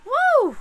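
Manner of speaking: sudden high loud woo; startled high woo of strain. Real, not acted. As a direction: cry out high, overwhelmed. Overwhelmed during reading.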